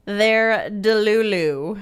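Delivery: slow, savoured They're Delulu; lingering over the new word Delulu; drawn-out delulu. Shown as a demonstration. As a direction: stretch out Delulu with relish. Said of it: "This is my new favorite".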